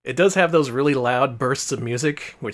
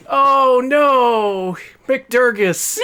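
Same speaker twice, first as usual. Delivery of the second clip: anguished cry; 11 semitones higher, 9 dB louder, much slower than usual. Real, not acted. Mourning the lost contestant.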